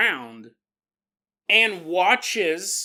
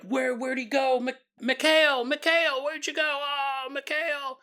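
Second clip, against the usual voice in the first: high-pitched panicked calling for a friend; acted freaking out; 8 semitones higher than usual. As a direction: call out for the vanished friend, pitched up. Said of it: "you hear the friends freaking out".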